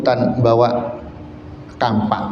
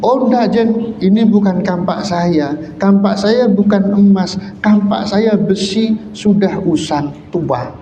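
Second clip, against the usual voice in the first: put-on higher, earnest refusal from the old man; old woodcutter telling the jin his axe is iron, voice higher; honest old man; 7 semitones higher than usual. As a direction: insist it is not your axe.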